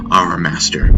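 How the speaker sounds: usual speaking voice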